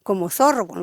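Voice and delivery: usual speaking voice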